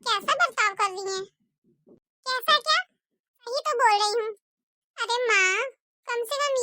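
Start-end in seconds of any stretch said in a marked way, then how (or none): 2.3-4.3 mother-in-law snapping. Acted. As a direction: bite back at the son. Mother-in-law snaps at son. (बेटे पर भड़कती सास)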